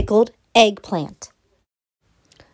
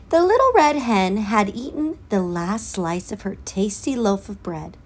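plain reading voice

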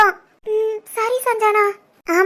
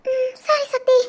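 soft, higher voice close to tears; tearful; 4 semitones higher, 3 dB quieter, much slower than usual. Acted. Wife saying goodbye to her husband, tearful. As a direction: agree while holding back sobs. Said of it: "இவ்வளவு கஷ்டமா பேசுறா".

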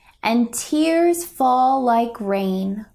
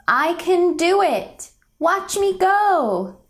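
pitched up high, bold and proud; brave and eager; 6 semitones higher than usual. Acted. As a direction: shout it like you just found your courage. Brave heart, confident child.

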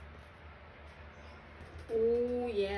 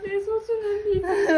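mock wail; 8 semitones higher, 8 dB louder, slower than usual. Mourning lost beads, laughing.

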